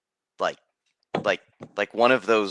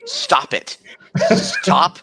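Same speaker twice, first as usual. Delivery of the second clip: a real laugh breaking through a high, louder stop; can't hold back the laugh mid-scold; 6 dB louder, much slower than usual. Real, not acted. Telling the player to stop while laughing, laughing plea.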